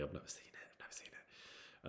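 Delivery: hushed, voiceless aside; whispered confession. Real, not acted. Admitting never having seen Game of Thrones, whispered. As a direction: drop to a whisper, fading almost to nothing.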